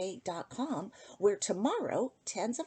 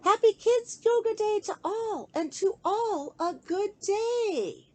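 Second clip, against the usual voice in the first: high, loud, joyful call, easing slower at the end; exclaiming; 11 semitones higher, 7 dB louder, slower than usual. Acted. Little girl calling goodbye to everyone, excited exclaim. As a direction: sing it out as a bright farewell. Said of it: "I heard her exclaim".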